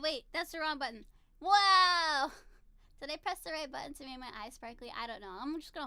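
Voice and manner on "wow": loud, drawn-out, higher-pitched wow; put-on starstruck exclamation; playful awe. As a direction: belt out one long note of wonder. Redoing the starry-eyed exclamation.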